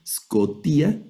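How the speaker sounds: "Scotía" with the í stressed and rising high; stress on final iota. Shown as a demonstration. Teacher showing where the Greek accent falls, stressed í. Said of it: "el acento está sobre esta iota".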